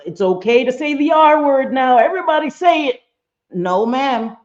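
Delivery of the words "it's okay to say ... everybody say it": high, singsong mock-cheerful voice; mocking others' excuse. Streamer parodying people who excuse a slur, high mocking voice. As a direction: parody the crowd, pitched up and climbing.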